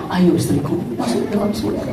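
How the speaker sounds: soft, tender husband calling; gentle invitation. Retold or imitated. Husband welcomes his wife into paradise, softly. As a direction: coax her in gently.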